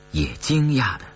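plain reading voice

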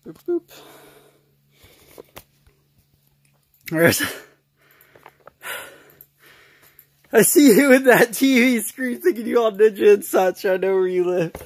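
shouting at the camera; loud playful shouting. Real, not acted.

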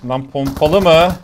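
loud, slow, drawn-out disbelief; startled shout. Real, not acted. Gamer startled by an enemy shotgun, loud shout. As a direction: shout it out in shock. Shotgun surprise mid-fight.

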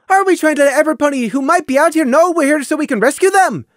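high and loud, arguing. Acted. Lily arguing loudly with Prey about rescuing villagers. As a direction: argue back loudly. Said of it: "just as loudly".

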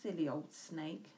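usual speaking voice

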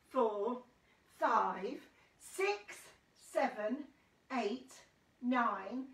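measured count, a pause after each number; spaced-out teaching count; counting aloud. Shown as a demonstration. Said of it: "counting time now".

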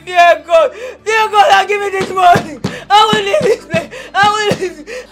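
crying, loud insistent demands; tearful shouted pleading, relentless; desperate shouting. Acted. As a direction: wail and refuse to budge. Desperate niece refuses to leave, demands money.

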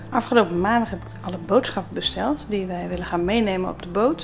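ordinary talk

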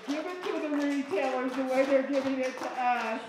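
loud rally cry. Real, not acted. Rallying line to the crowd.

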